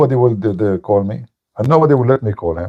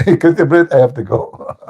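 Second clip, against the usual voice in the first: ordinary delivery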